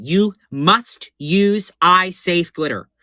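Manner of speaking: You must use eye safe glitter, slow with weight on eye; slow emphatic warning. Shown as a demonstration. Warning viewers a third time, slow and emphatic. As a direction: hammer the word eye home slowly. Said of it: "I'm gonna say it again".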